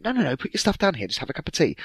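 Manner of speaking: high, fast, firm no; mother blocking the stairs; insistent mother. Retold or imitated.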